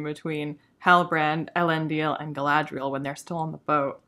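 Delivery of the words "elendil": ordinary talk, nothing marked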